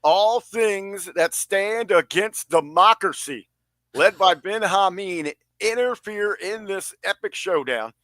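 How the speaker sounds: slow, high, grandiose booking speech; mock wrestling promo. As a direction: proclaim it slowly like an epic showdown.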